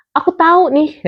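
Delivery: high, excited know-it-all; eager. Retold or imitated. Daycare staff eagerly recognizing the condition, high excited. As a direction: jump in eagerly, pitched high. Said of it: "kata dia gitu".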